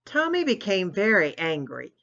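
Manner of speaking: plain reading voice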